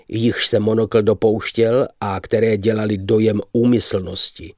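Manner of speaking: plain reading voice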